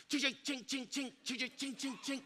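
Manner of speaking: very soft rapid chings; tiny mouth-made Morse taps; imitated Morse clicking. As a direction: mimic a telegraph reply quietly. Imitates Morse reply coming back.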